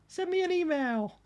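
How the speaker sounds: very high, drawn-out silly voice; high-pitched mock jingle, stretched out; playful put-on call. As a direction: sing it out slowly in a squeaky falsetto. Playful call at on-screen graphic.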